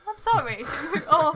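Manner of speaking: loud, high, giggling apology; cracking up; laughing. Real, not acted.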